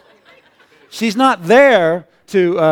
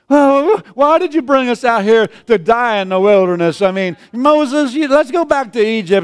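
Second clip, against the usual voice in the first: whining Israelites; 5 semitones higher than usual. Retold or imitated. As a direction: wail like frightened people begging to turn back. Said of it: "They got all worked up".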